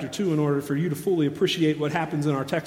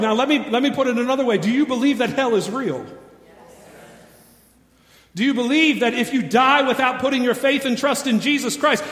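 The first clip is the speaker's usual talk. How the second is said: ordinary talk, nothing marked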